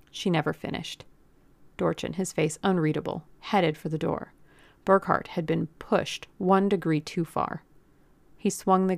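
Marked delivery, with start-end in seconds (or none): none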